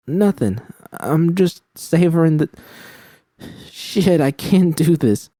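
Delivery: high, strained voice cracking; voice breaking. A narrator's reading. Judy admitting to V she can't go on, voice cracking high. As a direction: hold it together until it gives way, high.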